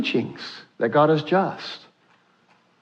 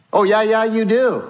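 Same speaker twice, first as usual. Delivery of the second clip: insistent retort; 5 semitones higher than usual. Acted. God retorts in acted argument.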